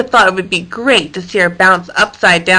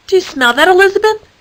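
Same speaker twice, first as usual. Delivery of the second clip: curious sniffing question; 10 semitones higher, faster than usual. A narrator's reading. Danielle voiced, sniffing.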